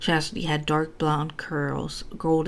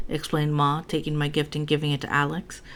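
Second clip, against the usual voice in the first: usual speaking voice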